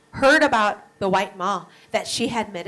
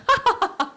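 laughs; 12 semitones higher than usual. Acted. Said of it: "Mary laughed".

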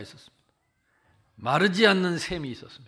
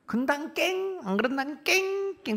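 high, slow mock yelp tacked on each "깽"; mocking dialect taunt; 10 semitones higher, slower than usual. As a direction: tease like the coworkers, high and slow. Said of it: "자기들끼리 그랬었더라고". (높고 느리게 "깽"을 붙여 놀리는 흉내)

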